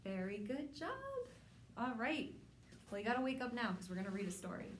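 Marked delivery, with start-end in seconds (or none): none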